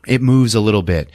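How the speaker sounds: ordinary talk, nothing marked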